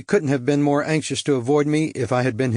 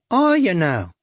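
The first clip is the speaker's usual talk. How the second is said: higher, coy cockney come-on; cockney prostitute; 6 semitones higher, slower than usual. A narrator's reading. Suzette luring the stranger, coy cockney come-on. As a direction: tease him in, sweet and brassy.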